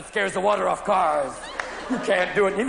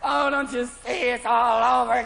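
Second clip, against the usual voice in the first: raised, hammed-up distraught outcry; mock despair; 5 semitones higher than usual. Drunk learning of last night, hammed-up despair. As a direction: wail it in mock horror.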